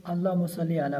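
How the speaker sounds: "अल्लाहुम्मा" rattled off with a clipped Allah; careless reading. Shown as a demonstration. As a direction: rush "अल्लाहुम्मा" so the name loses its length. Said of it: "बाज़ दफ़ा लोग". ("अल्लाहुम्मा" जल्दी में दबाकर)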